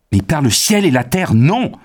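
loud, high outburst of outrage; furious cry. A narrator's reading. Young man refusing the professor's request, furious shout. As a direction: explode into an angry refusal. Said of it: "s'écria Arthur avec colère".

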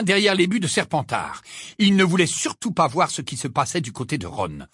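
ordinary delivery

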